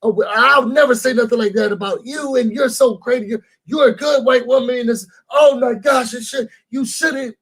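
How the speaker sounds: high, gushing mock reassurance; imitating their eager denials; mocking the women. Mocking the women who reassure her, high and gushing.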